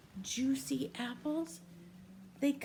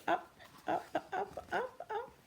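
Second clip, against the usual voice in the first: each up climbs higher in pitch; rising up, up, up; 9 semitones higher than usual. Acted. Reading to a child, voice climbs up with each up. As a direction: lift the voice step by step like climbing.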